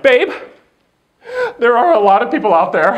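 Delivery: high, loud mock-nervous warning; hammed-up stage nerves on another's behalf; nervous wife. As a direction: imitate a jittery wife before the show. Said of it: "not as nervous as I am".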